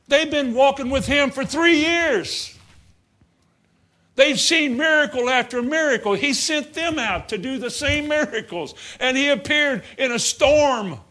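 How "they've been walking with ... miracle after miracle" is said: ordinary talk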